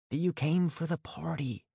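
hushed, half-whispered line. Acted. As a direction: keep it down so mom won't hear. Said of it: "I whispered in case my mom".

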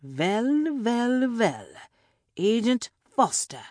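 put-on villain in a vague European accent, pitched high; mock-menacing greeting with a hammy European accent; European-accented villain, drawn-up and theatrical; hard-to-place European accent. A narrator's reading. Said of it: "a hard-to-place European accent".

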